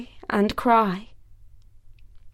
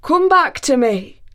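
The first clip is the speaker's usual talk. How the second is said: louder, higher call to come back; pleading call; 5 semitones higher, 5 dB louder than usual. Acted. Thor calls to his vanished wife, pleading. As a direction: call out, begging her return. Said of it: "he called".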